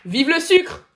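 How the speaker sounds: high, very loud cheer; shouted slogan. Pretend. Cheering for sugar, high shout. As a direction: shout it like a rallying cry.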